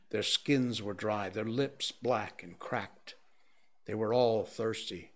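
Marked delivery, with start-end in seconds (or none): none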